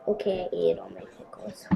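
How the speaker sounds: soft unvoiced whisper. Real, not acted. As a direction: whisper it like a secret. Sly confession about the pickles.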